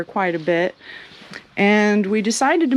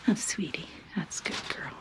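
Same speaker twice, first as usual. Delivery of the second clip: soft whisper to a pet bunny; hushed coo; 3 semitones lower, 15 dB quieter, faster than usual. Real, not acted. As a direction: breathe it gently to the rabbit.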